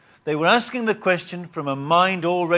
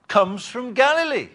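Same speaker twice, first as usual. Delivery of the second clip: normal talking voice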